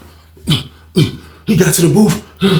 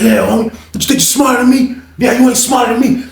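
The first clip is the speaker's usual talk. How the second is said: higher, mocking challenge; mild mock sneer; taunting; 8 semitones higher than usual. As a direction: rub it in his face. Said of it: "He was like".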